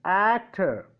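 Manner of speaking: slow AC-tor, first syllable drawn out; stress on first syllable. Shown as a demonstration. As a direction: stretch and hit the "ac" of actor. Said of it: "Stress, actor".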